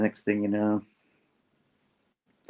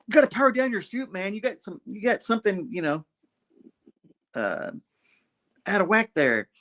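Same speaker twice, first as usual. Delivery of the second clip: lightly voiced Spidey, higher, casual; 9 semitones higher than usual. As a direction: play a laid-back hero, pitched up. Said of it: "he's like".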